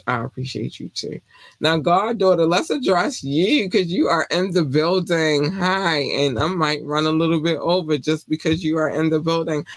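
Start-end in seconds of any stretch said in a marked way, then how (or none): none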